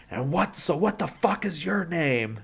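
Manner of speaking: mock confrontational challenge, hammed up; aggressive. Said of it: "like, really aggressively".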